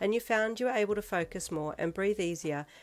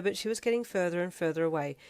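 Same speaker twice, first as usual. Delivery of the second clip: usual speaking voice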